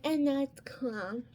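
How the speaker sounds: slow, drawn-out sweet-voiced imitation; mock-sweet, lingering reassurance. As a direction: imitate a scary man speaking sweetly. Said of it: "He had a very sweet voice though".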